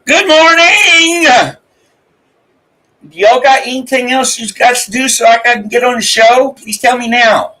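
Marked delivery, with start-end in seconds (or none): 0.0-1.6 high, slow sing-song good morning; drawn-out greeting. Real, not acted. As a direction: stretch it out like a cheery radio host. Morning greeting to stream chat.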